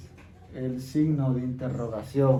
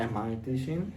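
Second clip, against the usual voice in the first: "Am I teaching?" ending with a rise on "teaching"; question intonation; 3 semitones lower, 5 dB quieter than usual. Shown as a demonstration. Teacher demonstrating question intonation to the class, rising tune. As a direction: lift the voice on "teaching" to make it a question. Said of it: "Para la entonación ya sería".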